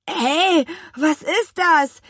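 high, loud, drawn-out protest; alarmed cry. Acted. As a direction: cry out startled under attack. Twins suddenly pelted with peas.

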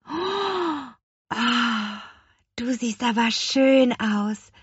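put-on slow, high, marvelling girl; awed. A narrator's reading. Lea marvels at the witch's finest outfit, awed. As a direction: gasp at her beauty. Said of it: "staunte Lea".

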